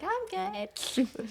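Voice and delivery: very high, innocent-sounding brush-off; squeaky dodge. Retold or imitated. Dodging her stepdad's beer offer, squeaky high voice. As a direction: squeak it out to hide the secret. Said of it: "And I was like".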